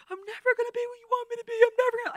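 very high, loud, fast wailing through tears; weeping cry. Retold or imitated. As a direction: sob it out in despair. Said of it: "I'm, like, weeping".

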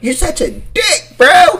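mimicked annoyed woman. Listener plays the annoyed woman, mock scolding. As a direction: imitate her, punching the last word.

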